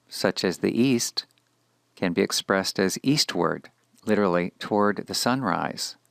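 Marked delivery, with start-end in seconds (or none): none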